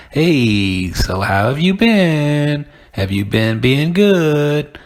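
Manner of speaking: kindergarten teacher voice. Retold or imitated. To homeboys on the street, teacher-style baby talk. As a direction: greet your homeboy like he's five. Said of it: "talking about".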